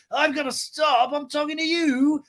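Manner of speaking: high, slow mock indignation; drawn-out high-pitched retort; Lisa. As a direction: imitate an affronted woman in falsetto. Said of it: "And Lisa's like".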